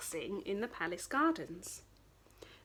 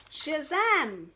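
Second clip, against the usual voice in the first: loud bright shazam; magic reveal; 6 semitones higher, 7 dB louder than usual. Acted. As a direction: burst it out like a spell. Magic transformation.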